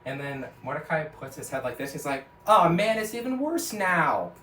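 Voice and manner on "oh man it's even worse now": loud high wail of despair, slowing down; hammed-up cartoon despair; loud groan. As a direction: cry out that it's getting worse. Cartoon character despairs loudly.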